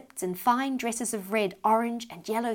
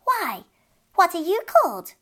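put-on fairy child voice; 9 semitones higher, 6 dB louder than usual. Fairy child speaks to Beth.

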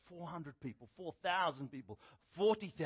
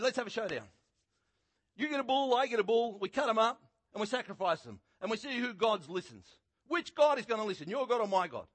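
loud, raised challenge to a showdown; bold challenge; 8 semitones higher, 8 dB louder than usual. Retold or imitated. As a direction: throw down the gauntlet. Elijah challenging the prophets.

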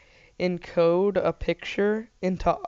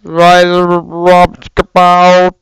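big loud slow call; loud announcement; 16 dB louder, much slower than usual. Pretend. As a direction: boom it out like a summons. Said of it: "it would sound like".

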